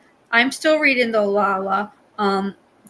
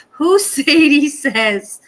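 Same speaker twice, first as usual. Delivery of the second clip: slow laughing disbelief; louder, slow laughing quote; laughing question; 5 semitones higher, 8 dB louder, much slower than usual. Retold or imitated. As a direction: laugh through the retold question. Retold chat with sister-in-law.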